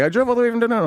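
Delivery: high put-on buyer voice; protesting buyer. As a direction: whine it high as a mock protest. Voicing the buyer's repeated protest.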